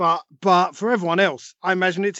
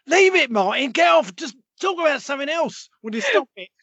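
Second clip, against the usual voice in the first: exasperated producer; 6 semitones higher than usual. Retold or imitated. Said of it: "imagining a producer in his ear going".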